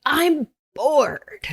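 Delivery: high, drawn-out mock complaint; kid's long-drawn "bored" complaint, retold. Acting out friend's bored kid.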